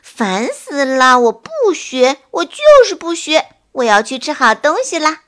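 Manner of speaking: put-on bored teenager whine; impatient. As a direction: huff it out, fed up and petulant. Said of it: "小鸟笨笨不耐烦地说".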